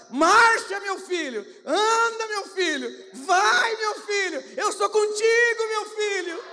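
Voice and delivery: loud commanding bursts of encouragement; God's rousing call. Acted. God shouting to his frightened son, loud and rousing. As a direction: urge the son onward at full voice.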